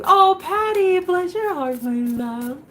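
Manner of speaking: high, syrupy bless-your-heart; sugary sweet greeting. Real, not acted. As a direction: coo at a kind viewer. Sweet greeting to viewer Patty.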